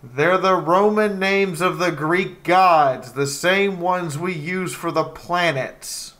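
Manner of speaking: loud, high exasperated explaining. Real, not acted. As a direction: spell it out, fed up.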